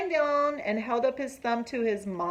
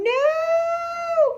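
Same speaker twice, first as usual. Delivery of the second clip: high, loud cry of dismay; 8 dB louder than usual. Acted. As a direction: squeal NO as the pizza falls. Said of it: "cried Froggy".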